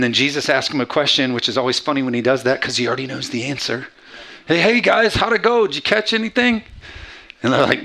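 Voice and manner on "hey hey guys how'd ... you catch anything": high, loud, chirpy hail; cheery call-out. Retold or imitated. Jesus asks the fishermen about their catch, cheery call. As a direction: call out breezily, pitched way up.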